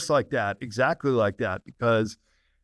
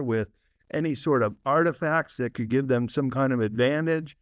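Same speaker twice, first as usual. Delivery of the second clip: ordinary talk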